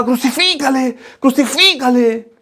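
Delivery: hint of an angry crowd calling out; mild mock crowd shout. Preacher echoing the people shouting at Pilate, mild mock yell. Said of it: "Y el pueblo gritaba".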